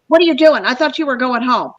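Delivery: ordinary talk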